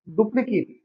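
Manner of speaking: डुप्लीकेट ending in a short "it"; डुप्लीकेट, clipped -it ending; noun duplicate. Shown as a demonstration. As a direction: say डुप्लीकेट with "-kit" at the end. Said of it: "आप उसे बोलेंगे".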